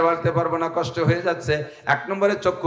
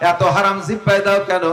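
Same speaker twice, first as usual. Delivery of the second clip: ordinary talk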